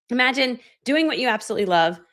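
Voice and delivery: ordinary delivery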